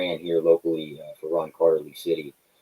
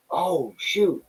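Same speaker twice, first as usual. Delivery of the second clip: mock surprise; 12 semitones higher than usual. Recognized by a fan.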